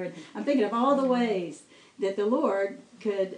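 normal talking voice

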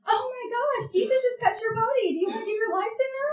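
very high, breathless-style excited mimicry; gushing friend. Friend leads healed man to Jesus, gushing high. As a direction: gush it high, ending in a rush.

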